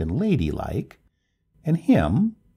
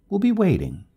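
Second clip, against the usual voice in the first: high, louder call trailing up the stairs; calling from downstairs; 14 semitones higher, 5 dB louder than usual. A narrator's reading. Neighbor going downstairs promises help, calling back. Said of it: "Her voice trailed up from the stairs".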